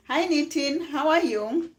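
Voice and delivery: high, loud hello; excited greeting. Real, not acted. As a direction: call out to a viewer. Live greeting viewers by name.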